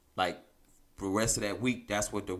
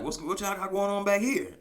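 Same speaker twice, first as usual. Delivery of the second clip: demanding coworker; 11 semitones higher than usual. Retold or imitated. Donors confronting lab workers.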